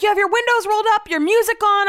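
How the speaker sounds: high, loud, rapid accusations; imitated yelling, rattled off high; retold screaming. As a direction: re-enact an outraged rant at a driver. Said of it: "but I was screaming".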